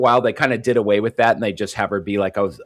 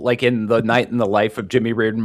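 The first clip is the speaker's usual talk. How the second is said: ordinary talk, nothing marked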